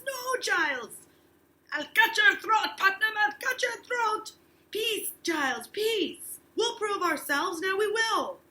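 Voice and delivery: high, loud acted yelling at the brawlers; courtroom shouting. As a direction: shout it over the uproar. Teacher acts the courtroom brawl.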